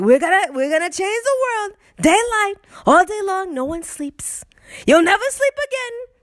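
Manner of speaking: high, hammed-up politician impersonation; Donald Trump. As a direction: rant in a high put-on voice, mock-ominous. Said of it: "I'm doing my Trump voice".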